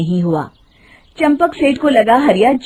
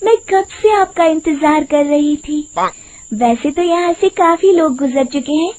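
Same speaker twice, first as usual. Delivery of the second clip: higher, honeyed woman's voice, luring him in; sweet voice; 6 semitones higher than usual. Acted. Disguised witch sweet-talking Hariya, high honeyed voice. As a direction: sweet-talk the cart driver like a lonely lady. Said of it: "मीठी आवाज में कहती है".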